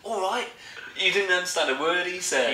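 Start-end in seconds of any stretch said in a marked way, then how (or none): 0.0-0.5 high London greeting; pitched-up mock Cockney hello; London accent. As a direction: imitate a cheery Londoner, high. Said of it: "He was talking pure London".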